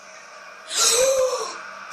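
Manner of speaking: long, high gasp of shock; startled gasp. Real, not acted. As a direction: suck in air in fright. Jump scare at end.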